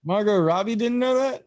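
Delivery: high-pitched, incredulous question; surprised disbelief. Real, not acted. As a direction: check it in real astonishment, voice shooting up. Reacting in disbelief to the news.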